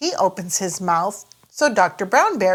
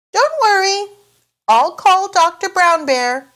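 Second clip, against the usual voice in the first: higher, louder cheerful dad voice; Daddy Pig reassuring; 11 semitones higher, 5 dB louder than usual. Acted. Daddy Pig promises to call the doctor, higher louder voice. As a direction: reassure the children brightly. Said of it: "says Daddy Pig".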